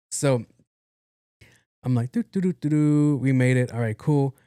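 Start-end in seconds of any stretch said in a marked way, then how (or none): 2.1-3.2 light sing-song driving noise; sung-out doo-doo-doo. Retold or imitated. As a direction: chirp a little cruising ditty. Mimics cruising along in car.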